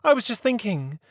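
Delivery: put-on a proper old lady leading the inquiry; prim lady voice. A narrator's reading. Mary Mabel covers herself.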